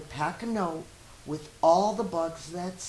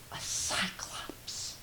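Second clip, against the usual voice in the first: whisper; 9 dB quieter, slower than usual. A narrator's reading. Child whispers the scary word.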